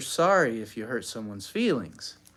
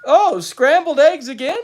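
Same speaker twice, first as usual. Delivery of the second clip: cheerful father; 14 semitones higher, 11 dB louder than usual. A narrator's reading.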